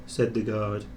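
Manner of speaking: plain reading voice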